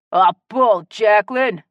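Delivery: indignant Cajun objection; loud protest. Acted. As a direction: scoff loudly at her claim. Man protests her jibe.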